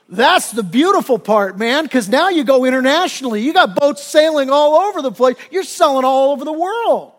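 loud, rising excitement; hammed-up, high and loud sales pitch; mock grand enthusiasm. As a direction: sell the dream like a carried-away businessman. Businessman's grand plan reaches its peak.

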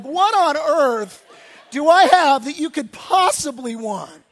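slow, loud, drawn-out incredulity; incredulous disbelief. Retold or imitated. Pastor recalls his own disbelief in the broken-down car, slow and loud. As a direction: stretch it out, loud, in disbelief at the idea.

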